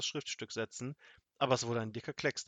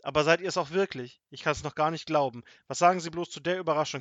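loud, higher, emotional grandmother; joyful outpouring, voice raised; overjoyed relief; 4 semitones higher, 8 dB louder than usual. A narrator's reading. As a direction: gush with relief and disbelief. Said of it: "Sie lachte und weinte gleichzeitig".

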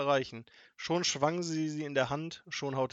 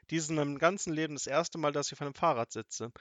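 usual speaking voice

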